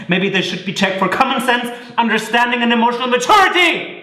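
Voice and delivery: building rant. Acted. Building rant in marital fight.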